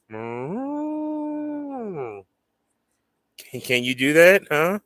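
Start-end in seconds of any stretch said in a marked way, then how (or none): 0.1-2.2 high, drawn-out moo; cow moo. Shown as a demonstration. Owner showing the dog how to moo, high sing-song. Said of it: "Can you go".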